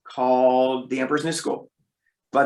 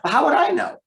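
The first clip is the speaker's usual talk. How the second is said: incredulous; 4 dB louder than usual. Real, not acted. Incredulous rhetorical question.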